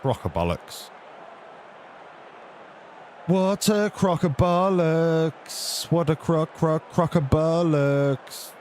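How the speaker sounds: slow, stretched out crock-a-bollocks; disgusted drawl. Real, not acted. Gamer disgusted at the rating, slow stretched crock-a-bollocks. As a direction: drag it out in disgust.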